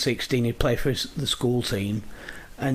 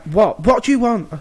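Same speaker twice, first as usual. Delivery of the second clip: exasperated dad; 6 semitones higher, 5 dB louder than usual. Retold or imitated. Dad pestered by child.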